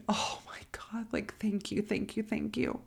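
soft, breathy gratitude; whispered thanks. Retold or imitated. Defendant giving thanks after acquittal, hushed relief. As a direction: murmur it to yourself, half whispered. Retold silent prayer of thanks.

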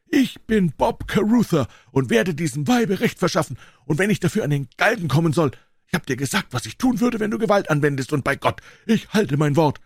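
high, fast, heated vow; vengeful Carruthers. Acted. Carruthers threatens Woodley with his revolver, high heated vow. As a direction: swear revenge at a high rush.

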